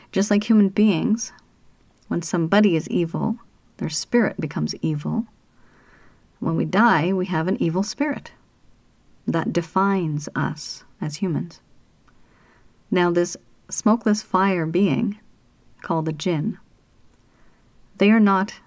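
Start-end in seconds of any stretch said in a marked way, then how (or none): none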